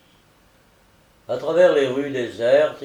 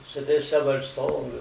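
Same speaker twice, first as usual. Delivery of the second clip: ordinary delivery, nothing marked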